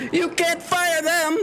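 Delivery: high, drawn-out mock outcry; mock screaming protest. Protesters screaming about firing workers, mock shriek. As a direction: cry it out slowly, high-pitched, without real volume. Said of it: "some people are screaming".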